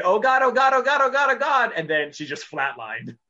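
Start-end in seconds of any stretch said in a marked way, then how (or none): 0.0-1.7 higher, louder Oh God repeated in mock ecstasy; mock ecstatic cries. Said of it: "she kept saying".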